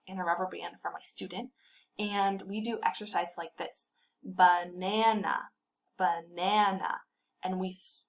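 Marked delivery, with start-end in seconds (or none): 4.2-5.4 BAnana... no, baNAna stretched slowly on the middle syllable; stressed syllable demo. Shown as a demonstration. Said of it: "pull the rubber band on the stress syllable". 6.0-7.0 "banana" slow, middle syllable lengthened; stressed syllable demo. Shown as a demonstration. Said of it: "pull the rubber band on the stress syllable".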